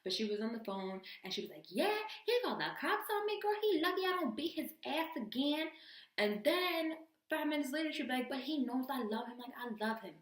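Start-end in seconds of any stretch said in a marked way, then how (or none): 1.7-5.7 high-pitched, hammed-up phone rant; mock angry rant. Woman ranting on the jail phone about a man, mock angry. 8.3-10.1 higher-pitched imitation of a devoted girlfriend; mock lovesick protest. Same caller swinging to love for her man, mock lovesick.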